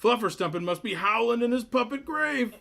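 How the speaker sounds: very high, loud, stretched-out mayor voice; a tiny piping voice for a big man, dragging the name out; mismatched mayor voice. Acted. Mayor greeting Cliff, in a squeaky, booming voice. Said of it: "voice does not match his stature".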